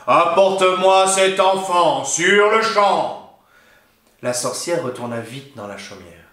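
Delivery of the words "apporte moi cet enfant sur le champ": very slow, louder ogre command; a monster who will not wait; demanding ogre. A narrator's reading. Ogre ordering the witch to hand over the baby, slow booming threat. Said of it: "gronda l'ogre".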